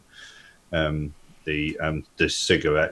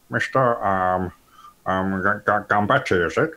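lightly raised polite stranger voice; a hint of a hesitant gentleman; character voice; 2 semitones higher than usual. Studley hesitantly greeting the boss, lightly voiced. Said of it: "he says".

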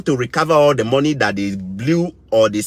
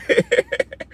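laugh. Real, not acted. Laughs at his own rant.

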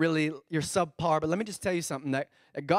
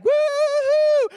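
sudden high, loud whoop; preacher whooping as the man testing his oxen; mock whoop; 9 dB louder than usual. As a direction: whoop like riding an ox for fun. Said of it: "be like".

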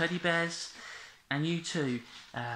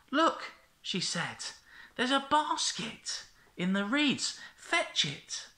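cartoon princess; 10 semitones higher, slower than usual. Princess speaks in the story.

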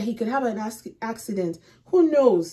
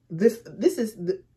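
ordinary talk

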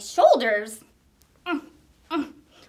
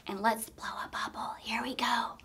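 whispering; 5 semitones lower, 11 dB quieter than usual. Pretend. Getting ready to blow bubble.

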